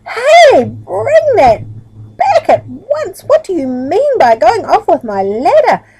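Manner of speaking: angry yell. Acted. Farmer up the tree yells at the ladder thief, furious shout. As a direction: bellow it from up the tree, outraged. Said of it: "yelled out angrily".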